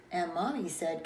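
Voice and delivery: ordinary delivery, nothing marked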